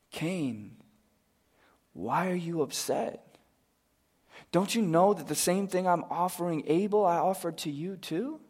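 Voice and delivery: quiet, tender plea to a brother; tender God. Acted. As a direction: coax him like a patient father. God gently questions Cain.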